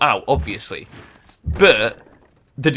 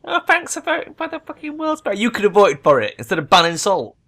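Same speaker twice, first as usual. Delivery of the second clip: very high, sneering sing-song; mock gratitude; 9 semitones higher, 4 dB louder than usual. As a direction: mimic their fake thanks. Mocking the rivals' thanks.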